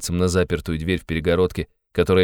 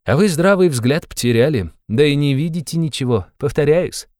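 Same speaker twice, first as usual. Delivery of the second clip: raised, higher voice of accusation; heated dialogue, higher than narration; agitated accuser; 7 semitones higher than usual. Acted. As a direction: throw it out as an indignant rebuke. Acts out a character.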